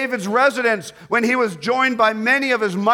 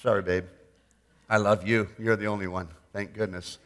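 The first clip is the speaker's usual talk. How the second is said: softer, half-joking apology to his wife; quiet aside to wife; 12 semitones lower, 8 dB quieter, faster than usual. Real, not acted. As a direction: mumble it off-mic to your wife.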